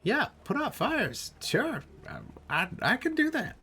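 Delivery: very high, hesitant falsetto; a little creature agreeing haltingly in a squeaky voice; Mog. Acted. Creature agrees to help Rolo put out fires, high-pitched and halting. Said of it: "Mog looks at you and goes".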